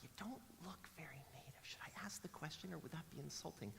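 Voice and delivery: tentative, very soft whisper; whispered inner thought. Retold or imitated. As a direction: whisper it like an unspoken awkward question. Voices people's private hesitant thoughts.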